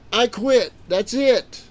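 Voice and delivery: loud, high giving up; I quit. Retold or imitated. A beaten fighter shouting that he quits. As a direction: shout it out as you give up.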